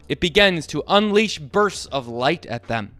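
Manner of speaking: ordinary delivery, nothing marked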